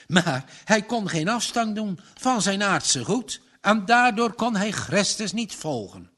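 ordinary delivery

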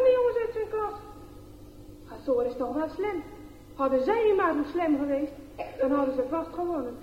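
plain reading voice

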